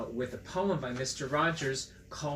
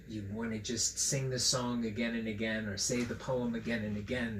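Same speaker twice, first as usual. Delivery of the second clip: ordinary delivery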